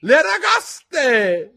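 high, long, loud shout; cried out. A narrator's reading. Gryphon leaps as he joins the instructions, loud high shout. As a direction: yell it high, holding the vowels. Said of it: "gridò il grifone".

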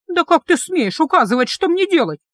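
outraged, pitch up; angry. A narrator's reading. Heroine indignant at the guard's orders, angry and high. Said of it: "рассердилась я".

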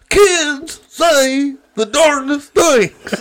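high, slow Cosby catchphrase; Bill Cosby impression. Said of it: "He's like".

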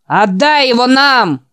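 loud, high, slow mocking yell; jeering shout. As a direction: shout the demand tauntingly from outside. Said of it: "прокричал снаружи другой насмешливый голос". (насмешливый крик)

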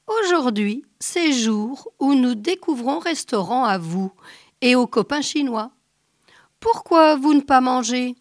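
loud booming giant, broken French; cheerful booming giant. A narrator's reading. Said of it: "le géant parle". (géant joyeux et tonitruant)

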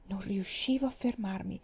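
ordinary delivery, nothing marked

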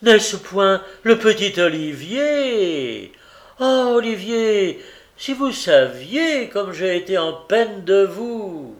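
hammed-up overjoyed greeting; feigned enthusiasm. A narrator's reading. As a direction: ham up fake delight like a pompous beadle. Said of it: "jouant sottement l'enthousiasme".

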